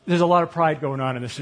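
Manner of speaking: usual speaking voice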